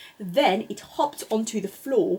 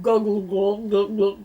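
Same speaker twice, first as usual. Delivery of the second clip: tummy gurgle; 4 dB louder than usual. A narrator's reading. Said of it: "went the duckling's stomach".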